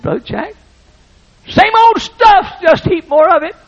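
loud, high, tearful country shout; tearful exclamation. Retold or imitated. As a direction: burst out with overflowing emotion. Tearful farmer's verdict.

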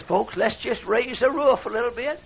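sarcastic send-up of a cheery worship leader; mock song leader. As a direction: parody a peppy song leader with scorn. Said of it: "He says".

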